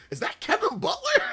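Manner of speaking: very high, incredulous squeal through laughter; mock amazement. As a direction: squeak the question in retold disbelief. Retells his amazed first reaction.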